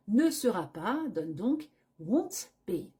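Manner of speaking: ordinary talk, nothing marked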